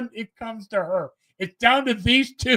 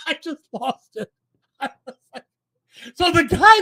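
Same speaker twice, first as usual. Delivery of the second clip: usual speaking voice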